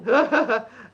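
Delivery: loud burst of laughter. Real, not acted. Co-host laughs at lucky Bill, loud laugh.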